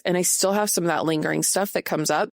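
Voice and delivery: usual speaking voice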